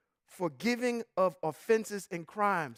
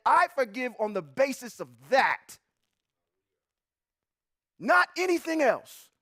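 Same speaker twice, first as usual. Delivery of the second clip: preaching build; 7 semitones higher than usual. Real, not acted. Pastor driving the point home to the congregation. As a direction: rise into full preaching fervor.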